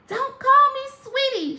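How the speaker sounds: high, loud, indignant snap; fierce retort. Acted. Sally Ann scolding folks who call her sweetie, high and loud. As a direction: bite back at the nickname. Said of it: "she told anyone who tried".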